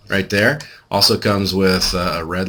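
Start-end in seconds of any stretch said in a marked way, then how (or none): none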